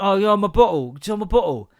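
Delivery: "Can you hold my bottle" squashed into a blur; garbled repeat. Retold or imitated. Son repeats request to confused twin, garbled. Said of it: "If you just said that properly".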